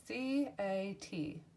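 letters slowly and clearly, one by one; spelled out slowly. Shown as a demonstration. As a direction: spell it for small children. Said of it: "teacher spelling the word".

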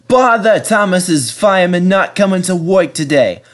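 cheerful bus regretting his promise; fretful bus voice. A narrator's reading. Bertie the bus complaining.

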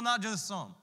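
ordinary talk

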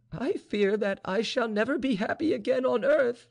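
high-pitched, anguished outpouring; despairing young woman crying out; grief-stricken cry. A narrator's reading. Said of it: "cried Vaninka".

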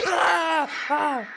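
high, loud scream of fright; startled scream. Real, not acted. Gamer caught by a game scare, startled loud scream. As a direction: scream as if just jumped at. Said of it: "Ay, me asusté".

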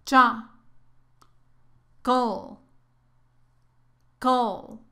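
"Cha" and "Col" as separate syllables with pauses; teacher models "Cha", then "Col", each alone; syllable drill. Shown as a demonstration. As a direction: break chocolate down, "Col" like a brief cl. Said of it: "¿Practicamos? Muy bien. Repeat".

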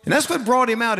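ordinary talk

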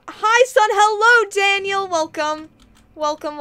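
loud, high welcome shout; excited greeting. Real, not acted. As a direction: call out to arriving viewers, high and loud. Greeting viewers who just arrived.

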